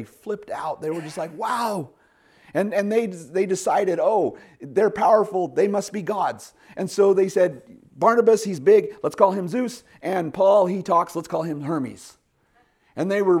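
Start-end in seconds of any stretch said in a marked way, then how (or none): 1.4-1.9 amazed wow. Retold or imitated. Crowd amazed at healing. 4.0-12.2 high, loud awestruck villagers; excited crowd. Retold or imitated. Crowd excitedly deciding they're gods.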